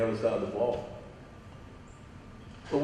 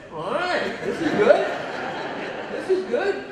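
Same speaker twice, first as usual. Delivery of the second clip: high, laughing glee; gleeful and laughing, pitched way up; delighted; 13 semitones higher than usual. Retold or imitated. Dad thrilled as the coach confronts the official, laughing glee.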